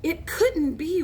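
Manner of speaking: usual speaking voice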